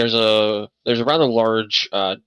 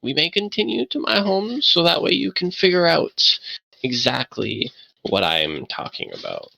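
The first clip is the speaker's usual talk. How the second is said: put-on lightly higher, polite old man voice; a hint of a frail old host inviting them; old man NPC; 7 semitones higher than usual. Old man inviting the party to his home, polite. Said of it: "gestures off and says".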